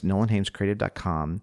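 quick, run-together plug; rushed. Real, not acted. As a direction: rattle off the web address. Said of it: "Sorry, too fast".

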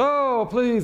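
pleading, pitched high; begging servant. Retold or imitated. Servant falls before the king, pleading. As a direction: beg for your life.

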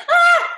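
high, loud shriek with a laugh; comic startled scream. Retold or imitated. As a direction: let out a sudden laughing shriek. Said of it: "I'm like".

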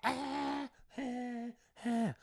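high, slow mocking drawl, then a fake laugh; sarcastic heckler. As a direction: sneer it up high, then cackle. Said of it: "then he starts going".

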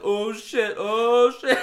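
high, loud shout building to a full yell; mock excited yell. Reenacting the fake big-fish video, excited yelling. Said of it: "at the top of my lungs".

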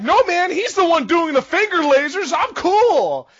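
high, loud imitation of a man pleading innocence; mock-indignant player shifting the blame; protesting player. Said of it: "read his lips being like".

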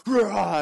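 loud, high battle roar; action-hero roar. Acted. Actor bursts out of the water on camera, roaring. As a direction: roar like a burly movie hero. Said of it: "he's acting on a scene".

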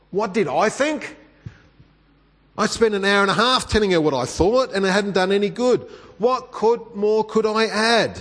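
high, rising indignant retort; worked-up, incredulous self-questioning; exasperated inner outburst. Retold or imitated. Mock-exasperated reaction to her question.

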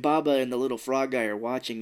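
usual speaking voice

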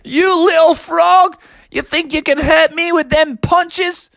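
high, mocking cackle into a jeer; hammed-up gloating laughter and taunt; taunting villain laugh. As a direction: laugh big, then sneer in a high put-on voice. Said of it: "threw back his head and laughed".

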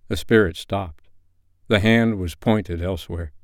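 plain reading voice

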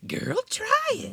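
very high campy drag queen voice; man doing a woman's voice, sassy diva; Lady Bunny impression. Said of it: "my Lady Bunny impression".

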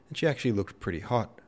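plain reading voice

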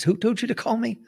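squeaky mock officer asking who sent you; high mocking colonel voice. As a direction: voice jumps way up, incredulous. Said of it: "He says".

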